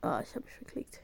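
whispered regret; whispering. Real, not acted. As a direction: breathe it out softly. Misclick admission.

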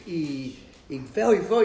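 mild frightened whimper; a hint of a terrified teenager; slow, frightened boy. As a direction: drag the words out like a scared kid. Boy describing the scratching.

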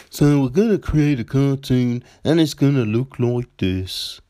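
a light mock studio-boss voice; a hint of a goofy pitching producer; silly put-on voice. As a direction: lightly voice a bland cartoon executive. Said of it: "I don't know why I use that voice".

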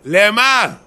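high, loud why; exasperated outcry. Acted. Man berating himself for the quarrel, exasperated. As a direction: cry it out in mock despair.

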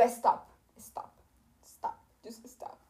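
hushed whisper, slowing down; whispered plea. Retold or imitated. Begging her nagging dad to stop, whispered. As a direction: hiss it to make him stop.

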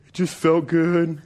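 put-on slow, small child's admission with a laugh; sheepish kid. Son admitting to dad why he bit, slow and sheepish. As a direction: own up guiltily. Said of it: "So why did you do it?".

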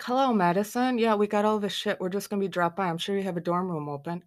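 sarcastic pretend call, starting big and easing off. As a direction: lay on the fake cheer, sarcastically. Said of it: "My sarcastic light flips on".

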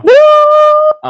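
high, loud whoop; excited whoop. Real, not acted. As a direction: cheer it out. Celebrating fiftieth episode.

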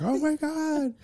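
mock high-pitched fan gasp; excited mom. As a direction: squeal it like a starstruck mother. Mom freaking out over Jess news.

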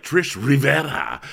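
lightly hammed-up Spanish-style accent on the name; random put-on accent. Imitating Kyle's name in the movie, random put-on accent. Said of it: "random accents that they would get".

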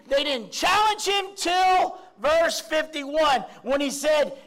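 rising into a full-voiced sermon shout; preaching at full force. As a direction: drive it home from the pulpit, climbing.

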